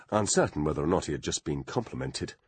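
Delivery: ordinary delivery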